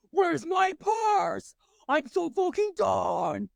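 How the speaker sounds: half-shouted Halloween meltdown; mock bar yell. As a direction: yell it like you're storming out of the bar. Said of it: "in my very deep, manly voice that I get when I yell".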